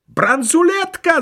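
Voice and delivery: very high, drawn-out yell; piercingly high, stretched-out cry; crowd's cry. A narrator's reading. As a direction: shriek it like guards pouncing on a find. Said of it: "закричали остальные".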